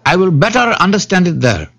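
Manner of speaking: high, earnest jackal voice; dim-witted jackal. A narrator's reading. Jackal asking the Brahmin to take him there, high-voiced. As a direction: request it high as a foolish jackal.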